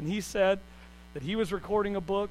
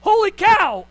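sudden high shout of alarm; mock panicked cry; 12 semitones higher, 13 dB louder, slower than usual. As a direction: yell it out like a spooked sailor. Disciples crying out at ghost.

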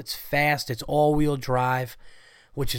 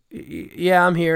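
stammering, slightly raised mock-timid reply; afraid to answer; 5 semitones higher, 4 dB louder than usual. Scared kid answering the teacher, hesitant stammer. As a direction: imitate a nervous kid stuttering out an answer. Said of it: "he's afraid to say".

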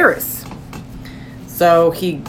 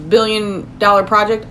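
usual speaking voice

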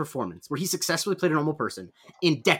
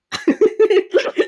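high burst of laughter; 7 dB louder than usual. Real, not acted. Laughing at accent claim.

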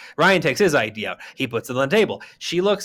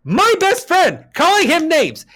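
high, loud cries of indignation; outraged shout; 13 semitones higher, 5 dB louder, slower than usual. Real, not acted. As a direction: yell it as if personally wronged. Outraged on best friend's behalf.

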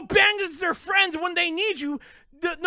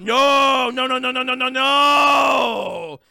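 louder, long stretched final no; drawn-out shouted no; 4 semitones lower, 4 dB louder, much slower than usual. Real, not acted. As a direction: belt the refusal, stretching the last one. Refusing the mockers, ranting.

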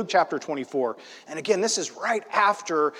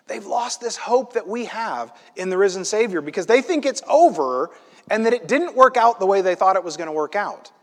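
ordinary delivery

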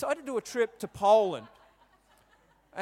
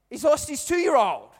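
indignant outburst; 7 semitones higher, 9 dB louder than usual. Retold or imitated. Indignant at unhelpful shop lady.

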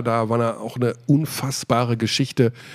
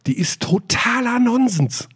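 higher, slow, fed-up ranting; exasperated rant; 9 semitones higher, slower than usual. Real, not acted. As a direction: spit it out in disbelief. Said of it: "den Rant rein hier".

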